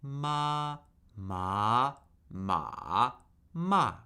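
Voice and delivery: warm up "ma" through the four tones; four pitch shapes on "ma", in order; four Mandarin tones. Shown as a demonstration. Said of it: "We're warming up here with tones".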